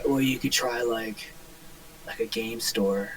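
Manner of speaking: low, very quiet droning suggestion; soft deadpan, zero effort; monotone clerk impression. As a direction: imitate an apathetic employee, trailing along tonelessly. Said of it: "the most monotone voice".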